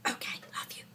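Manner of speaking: very soft whispered sign-off; whispering. Real, not acted. Vlogger saying goodbye to viewers, whispered.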